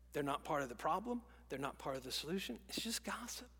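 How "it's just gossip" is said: whisper. Acted. Dismissive aside in sermon.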